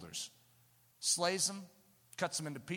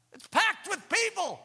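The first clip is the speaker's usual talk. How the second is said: packed inn, exclaimed; 11 semitones higher, 6 dB louder than usual. Real, not acted.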